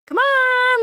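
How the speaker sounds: very high mock farm call; pig-calling holler. Host acting the expected pig calling, high holler. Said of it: "I thought it was going to be like a".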